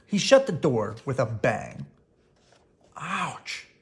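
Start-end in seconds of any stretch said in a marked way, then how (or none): 3.0-3.7 sharp yelp of pain; pained ouch. Acted. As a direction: cry out as the foot gets hit. Foot slammed in door.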